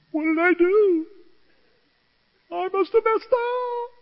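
high, drawn-out mock whimper; wimpy self-pity. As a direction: whine like a crushed crybaby. Mimics own wimpy hurt reaction.

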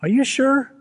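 high, doubtful "are you sure"; retelling his disbelief at the clerks; incredulous. Retold or imitated. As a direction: question them in mock disbelief.

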